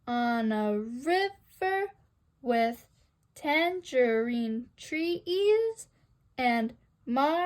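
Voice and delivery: "picture yourself in a boat on a river with tangerine trees and marmalade skies" very slowly, in DUM-da-da feet; dactylic scansion. Shown as a demonstration. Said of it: "Notice how it's stressed".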